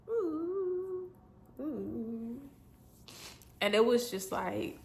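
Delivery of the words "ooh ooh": shaky cry-sound breath out; real tearful exhale; tearful ooh. Real, not acted. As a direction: let a crying ooh escape. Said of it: "I'm not gonna cry".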